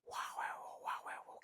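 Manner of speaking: whispered mock spirit babble; imitating spirit's language. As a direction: mimic the old spirit's strange speech in a hush. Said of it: "dia menggunakan bahasanya kayak gini". (menirukan bahasa arwah sambil berbisik)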